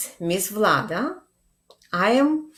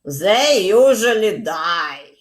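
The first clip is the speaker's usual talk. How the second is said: father's voice; 2 semitones higher, 6 dB louder than usual. Acted. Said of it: "And his father said".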